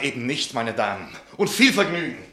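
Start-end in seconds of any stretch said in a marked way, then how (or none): 1.4-2.2 louder, higher mock-cheerful send-off; sarcastic farewell. Rebuffed young man to the ladies, sarcastic, louder and higher. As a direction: wish them fun with a bite.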